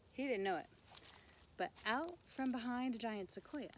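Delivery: ordinary delivery, nothing marked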